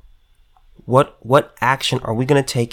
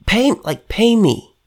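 mock landlord; 6 semitones higher, slower than usual. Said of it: "Regular landlords saying".

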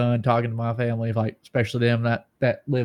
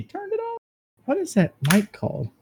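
falsetto squeak, much higher; high falsetto disbelief; mock shock.

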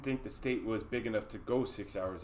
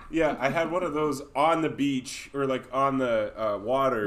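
louder, bragging tourist; imitating the customer; 2 semitones higher, 8 dB louder than usual. Boston customer boasting to the waiter, louder. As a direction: quote her loudly and boastfully.

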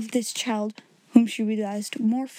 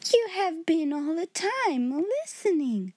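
Miss Minchin; 6 semitones higher than usual. A narrator's reading. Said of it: "said Miss Minchin".

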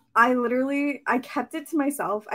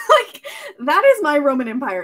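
laughing; 4 semitones higher, 7 dB louder than usual. Real, not acted. Laughing about favourite obsession.

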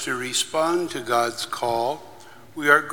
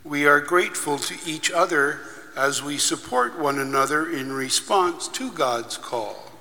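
prayer; 2 semitones higher, 4 dB quieter than usual. Acted. Leads a liturgical prayer.

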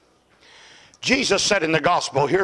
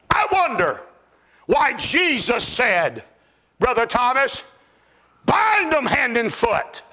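driving pulpit cadence, climbing higher; fiery preaching; 11 semitones higher than usual. Acted. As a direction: build urgency phrase by phrase into a shout. Preaching at full force.

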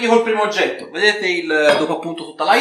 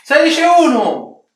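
auctioneer bid calling; 7 semitones higher, 5 dB louder than usual. Acted. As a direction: call out each bid like an auctioneer.

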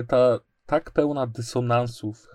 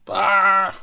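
high roaring battle cry; 8 semitones higher, 9 dB louder than usual. Pretend. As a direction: roar like a charging knight. Charging into battle, sword raised. (okrzyk bojowy)

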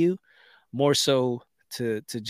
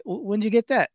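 surprised; 7 semitones higher than usual. Retold or imitated. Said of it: "I was like".

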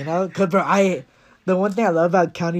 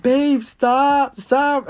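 lightly voiced girlfriend, higher and louder; a mock-pleading girlfriend begging him to stop; 10 semitones higher, 7 dB louder, much slower than usual. Girlfriend on the swing ride, begging him to stop, higher and louder.

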